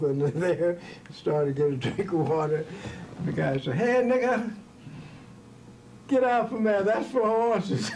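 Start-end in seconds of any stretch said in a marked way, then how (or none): 3.7-7.9 high-pitched yell to get away; high, scolding holler in a funny anecdote; retold indignant holler. Retold man yelling him off the trough.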